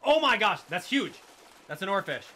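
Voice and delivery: loud, high triumphant yell; excited shouting. Real, not acted. Player announcing a huge oarfish catch, excited shout. Lands a huge rare fish.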